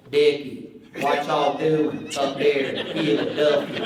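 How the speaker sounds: slow, heavy North Carolina drawl; Southern drawl. Retold or imitated. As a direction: stretch every word out like a Carolina country boy. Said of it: "it was like this".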